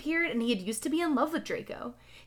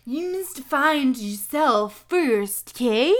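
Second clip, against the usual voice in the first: slow, hammed-up wizard voice; high, loud, slow old-wizard counsel; Dumbledore; 3 semitones higher, 6 dB louder, much slower than usual. A narrator's reading. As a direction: stretch it out as pompous advice. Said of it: "My Dumbledore voice again".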